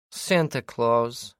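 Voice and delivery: slow, playful smiling voice. A narrator's reading. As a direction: let the smile be heard, drawn out. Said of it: "hear the smile in her voice".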